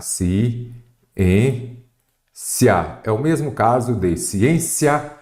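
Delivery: "Ciência" broken into ci, ên, cia with pauses; syllable by syllable. Shown as a demonstration. Teacher separating the word for students, syllable by syllable. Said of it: "primeira coisa é separar".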